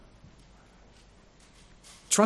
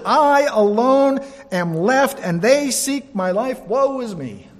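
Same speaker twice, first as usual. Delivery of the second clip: high, slow, a touch of self-pity; lamenting Elijah; 7 semitones higher, 4 dB louder, slower than usual. Acted. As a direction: whine it out like a man who feels all alone. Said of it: "So he said".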